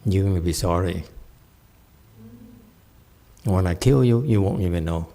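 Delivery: a hint of quiet menace, unhurried; menacing bodhisattva. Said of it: "when angry at you".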